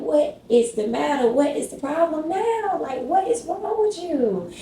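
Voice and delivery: put-on pleading at a baby who won't stop crying; exasperated. Frustrated at crying baby at night.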